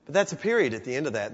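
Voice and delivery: ordinary talk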